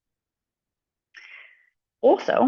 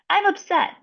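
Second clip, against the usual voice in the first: put-on much higher child's voice; little kid correcting a parent; 11 semitones higher than usual. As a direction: answer like a small upset child. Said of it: "the kid's like".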